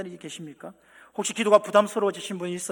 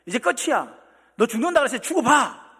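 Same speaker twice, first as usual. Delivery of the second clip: sharp, higher, louder rebuke; a harsh, scolding God who never speaks; mock angry scolding; 5 semitones higher, 7 dB louder than usual. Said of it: "이렇게 하지 않았어요".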